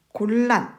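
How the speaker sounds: 곤란 said as 골란, gol-lan; correct lateralization. Shown as a demonstration. Teacher reading out an item for students, 곤란 as 골란. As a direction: double l in the middle of 곤란. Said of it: "곤란이 아니라 곤란이 되겠죠".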